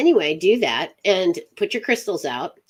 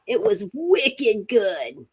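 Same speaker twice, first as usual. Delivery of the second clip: slow, higher put-on Massachusetts accent; Northeast accent; 4 semitones higher, slower than usual. Shown as a demonstration. Host attempting the Northeast, Massachusetts accent. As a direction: drag it out in a mock New England accent. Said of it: "not real great with the Northeast".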